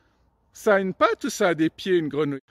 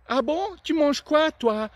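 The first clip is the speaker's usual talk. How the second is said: much higher, eager frog question; 7 semitones higher than usual. Acted. Frog asking the crocodile what it eats, much higher. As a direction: ask brightly and innocently.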